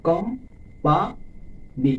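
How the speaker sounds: "Company" slowly as কোম ... পা ... নি; teacher counting out কোম, পা, নি; syllable by syllable. Shown as a demonstration. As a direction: break company into three beats. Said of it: "তিনটি syllable থাকে".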